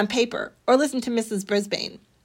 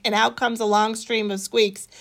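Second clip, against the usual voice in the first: plain reading voice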